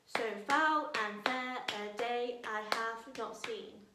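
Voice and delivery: slow, beat on every other syllable; clapped out. Shown as a demonstration. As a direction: clap along, one syllable at a time. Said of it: "clap out the line again".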